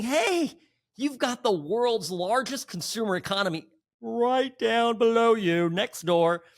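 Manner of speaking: high, loud exasperated urging, fading back near the end; mock Canadians pleading. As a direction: shout the obvious at their own leader, then settle. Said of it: "Canadians are going on there going".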